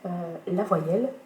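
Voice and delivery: usual speaking voice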